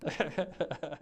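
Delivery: high laughter around the words; real laughing. Real, not acted. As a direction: agree while cracking up. Laughing along with the host.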